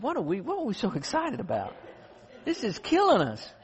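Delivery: hiking buddy laughing at their misery; laughing complaint. Retold or imitated. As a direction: groan and laugh at once about the pain. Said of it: "he said".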